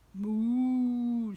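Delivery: loud lowing moo; long loud moo; mooing cow. Acted. Cow voiced in story.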